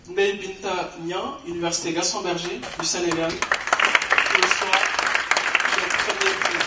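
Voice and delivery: slow, drawn-out winner reveal; suspenseful announcement. Real, not acted. Announcing the first prize winner to the audience, slow and suspenseful. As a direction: stretch each word to build suspense. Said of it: "un peu de suspense".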